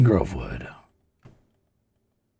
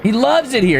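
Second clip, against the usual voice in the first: high gushing; 9 semitones higher than usual. Acted. Gushing over the figure.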